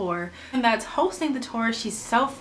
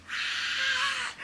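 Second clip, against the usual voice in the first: a short, very high squeal of joy; excited squeal; 6 dB quieter than usual. Retold or imitated. Hearing the apartment is approved, a short high excited squeal. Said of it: "screaming and I'm excited".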